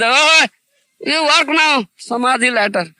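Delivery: very loud, high mock objection; mocking imitation. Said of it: "If you speak like this".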